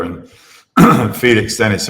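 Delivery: ordinary delivery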